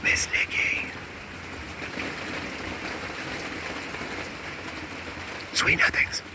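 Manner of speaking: low unvoiced whisper of sweet nothings; seductive whisper. Stepping up to Miss Nikki while Chad's away, seductive whisper. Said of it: "come up to the lady".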